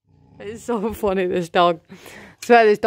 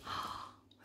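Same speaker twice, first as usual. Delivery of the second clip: whisper; 26 dB quieter than usual. Real, not acted. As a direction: breathe it out in hushed wonder. Watching dog secretly.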